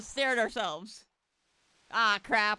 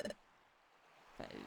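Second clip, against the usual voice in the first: whispered despair; 19 dB quieter than usual. Acted. [name] despairing over treasure.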